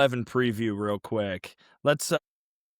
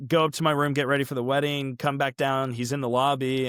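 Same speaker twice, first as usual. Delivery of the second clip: usual speaking voice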